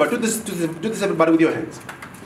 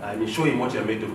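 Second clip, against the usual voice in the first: voice of emotion; 10 semitones lower, 7 dB quieter than usual. Shown as a demonstration. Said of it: "He says".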